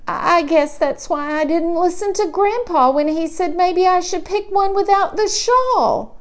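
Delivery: plain reading voice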